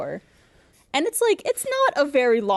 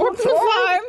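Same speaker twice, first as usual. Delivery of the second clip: old man impression; 13 semitones higher, 7 dB louder, much slower than usual. Imitating old neighbour.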